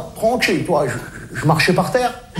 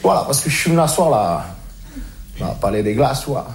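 much lower, softer, earnest voice; calmed-down sincere; 8 semitones lower, 3 dB quieter than usual. Acted. Reformed street guy shares his wise message, calm and low. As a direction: settle in and preach calmly. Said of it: "je me suis calmé".

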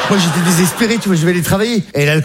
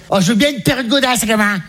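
high-pitched mum answering; mother's voice; 4 semitones higher than usual. Acted. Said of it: "qu'est-ce qui te ferait plaisir".

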